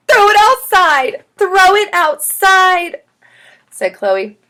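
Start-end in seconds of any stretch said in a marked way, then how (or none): 0.1-1.2 high, loud, drawn-out shriek; panicked scream. A narrator's reading. Chloe wants the worm gone, panicked screaming. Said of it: "she screamed". 1.4-3.0 slower, stretched loud wail; panicked scream. A narrator's reading. Chloe repeats her plea to teacher, panicked screaming. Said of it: "she screamed".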